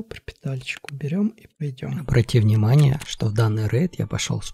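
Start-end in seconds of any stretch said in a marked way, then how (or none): 0.0-2.0 soft whisper. Real, not acted. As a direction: whisper it while sneaking. Whispered looting.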